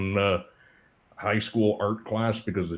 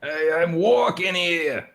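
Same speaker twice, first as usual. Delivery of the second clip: put-on higher, louder, slow New York accent; 8 semitones higher, 4 dB louder, slower than usual. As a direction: bark it like a New York cabbie. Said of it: "Don't do a Baltimore accent".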